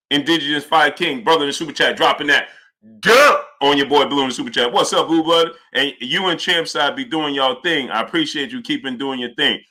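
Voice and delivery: ordinary talk, nothing marked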